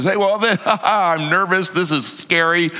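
high, nervously laughing disciple; nervous babbling. Acted. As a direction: blurt it out jittery, with an anxious laugh. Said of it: "when he's nervous has to say something".

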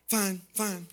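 quiet, clipped mock-sulky "fine"; a hint of a hurt wife brushing him off. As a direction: play the wife insisting she's fine when she isn't. Wife sulking after a tiff.

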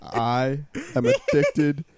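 slow, laughing mock confession. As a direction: confess it through laughter, drawn out.

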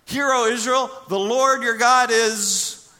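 loud, high preaching on the Trinity; full-force preaching. Acted. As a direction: preach it out to the congregation.

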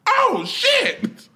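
high, slow yell of disbelief. Retold or imitated. Fan watching the game at home, yelling high in disbelief. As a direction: scream at the screen as he breaks free. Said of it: "I yelled".

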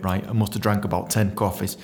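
ordinary delivery

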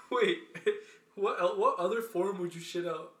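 laughing while asking; giggling through the question. Real, not acted. Laughing at gross story.

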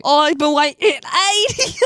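put-on fail at an Australian old-man accent while laughing; bad Australian old man. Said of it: "an old man Australian".

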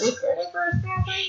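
high, slow, soft geezer grumble; grumpy old man. Retold or imitated. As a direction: croak it like a cranky old-timer. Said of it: "like an old man trying to get kids out of his yard".

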